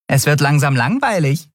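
high-pitched, lightly amused little character. Acted. Nelen teases Miko after winning, amused. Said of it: "sagte Nelen amüsiert".

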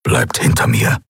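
low growl of a protective fighter; Keru's low warning growl. A narrator's reading. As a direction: growl it low, keeping them behind you. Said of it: "knurrte Keru".

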